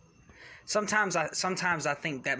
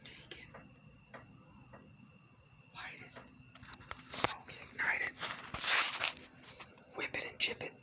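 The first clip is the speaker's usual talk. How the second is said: whispering; 7 dB quieter than usual. Acted. Whispered chant.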